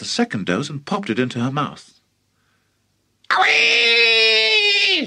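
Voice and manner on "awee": very high, loud screech; gleeful scream. Acted. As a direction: shriek as she shoots up. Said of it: "she screamed".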